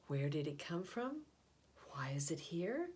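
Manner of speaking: ordinary delivery, nothing marked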